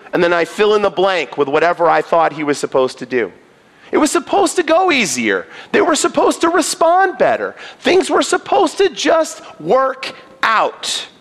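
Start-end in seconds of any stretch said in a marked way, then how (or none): 3.9-11.1 higher, indignant, building to a shout; frustrated complaint. Retold or imitated. Preacher mimicking his own frustrated complaints to God. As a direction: vent at God as if cheated.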